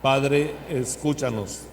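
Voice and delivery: loud call, slow drawn-out ending; loud liturgical response. Acted. Congregation response to prayer.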